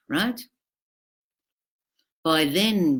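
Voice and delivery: ordinary delivery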